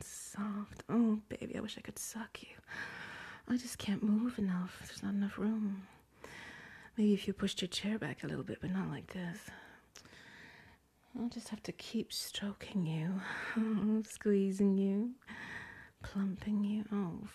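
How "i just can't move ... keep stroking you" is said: usual speaking voice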